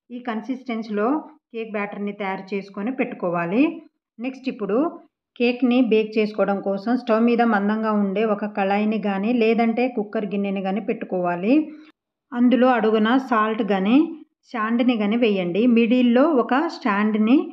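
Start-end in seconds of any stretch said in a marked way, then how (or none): none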